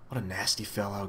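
softly gasped, mildly winded. A narrator's reading. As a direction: let it out in a soft, understated gasp. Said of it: "gasped Milo".

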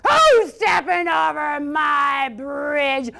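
slow, drawn-out roaring troll shout; troll's roar. Acted. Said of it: "roared the troll".

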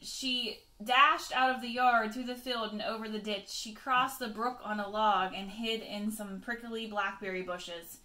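ordinary delivery, nothing marked

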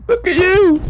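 squeaky high taunt, drawn out slowly; mocking high voice. Taunting friend Aiden in the game, squeaky high mocking voice.

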